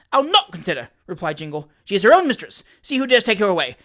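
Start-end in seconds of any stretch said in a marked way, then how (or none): 0.1-0.9 higher, louder, stress on not; defiant refusal. A narrator's reading. Jingle defying Perker, loud refusal. 1.8-3.8 louder, raised challenge; defiant challenge. A narrator's reading. Jingle challenging Wardle, loud defiance.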